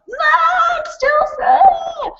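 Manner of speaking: very high, slow mock wail; drawn-out, hammed-up bawling; crying child. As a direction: screech it out like a child mid-tantrum. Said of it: "they're kind of really crying".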